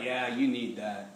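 very low, slow devil voice; tempting devil. Acted. Devil tempting someone to indulge, low and coaxing. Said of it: "all of a sudden the devil's like".

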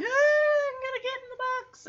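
very high panicked squeal; high, hurried pretend fright; mock panic. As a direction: wail it like a scared dog, hammed up. Retold panicking dog diving for cover.

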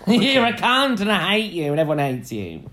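high, hammed-up hateful rant; put-on bitchy outburst; mock tirade. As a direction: pile on in a pretend catfight. Joins in mock Drag Race row.

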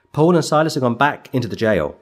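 normal talking voice

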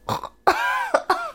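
harsh cough from chili; coughing on chili powder; spicy cough. Real, not acted. Choking on chili coating.

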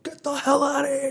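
high far-off yell; mock roommate yelling. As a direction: shout down from the top of the stairs. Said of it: "just yelling".

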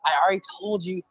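high, impatient mock mother nagging; exasperated mom. Mom outside the funhouse hurrying her lost son, high impatient voice. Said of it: "she's yelling".

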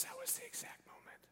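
hushed, voiceless whisper of realization; stunned whisper. Acted. Father realizing when his son healed, stunned whisper. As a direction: whisper it to yourself in awe.

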